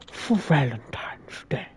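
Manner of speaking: whispered documentary aside. Acted. Streamer ends his wildlife narration in a whisper. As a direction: drop to a whisper.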